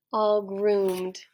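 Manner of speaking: plain reading voice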